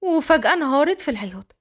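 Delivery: plain reading voice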